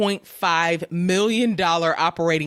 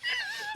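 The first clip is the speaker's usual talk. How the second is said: high giggle; 12 dB quieter than usual. Real, not acted. Laughing while apologizing.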